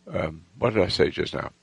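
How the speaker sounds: ordinary talk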